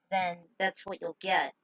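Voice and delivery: ordinary delivery, nothing marked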